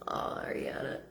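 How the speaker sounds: a breathless whispered aside; hushed whisper of a name. Real, not acted. Addressing spirit aside.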